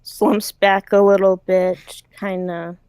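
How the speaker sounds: ordinary delivery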